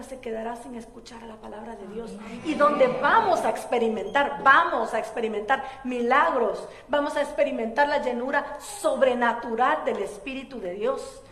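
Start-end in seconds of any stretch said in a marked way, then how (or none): 2.4-11.2 loud, driving preacher's proclamation; fervent sermon climax; full-force preaching. Acted. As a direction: preach it out with fire to the congregation.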